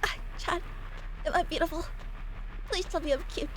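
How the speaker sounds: high, soft, put-on plea; mock needy pleading. Pretending to beg Chad for compliments, mock needy. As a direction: beg sweetly for reassurance, hammed-up.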